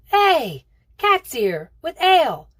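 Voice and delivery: put-on a boy yelling high and loud as he bursts in; young boy shouting his return at the top of his voice; excited shout. A narrator's reading. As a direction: call out over the racket, thrilled. Boy spots Kat, shouts.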